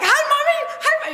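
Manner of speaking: put-on very high, loud sing-song child; boy greets his mother, very high and loud; innocent little boy. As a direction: chirp it like a sweet innocent kid. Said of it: "He's like".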